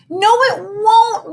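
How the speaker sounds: high, slow, whiny moan. Acted. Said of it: "moaned Missy".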